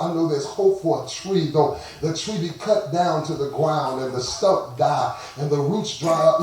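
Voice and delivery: sermon gathering steam; preaching. Real, not acted. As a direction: preach it, climbing toward a shout. Preacher builds to full force.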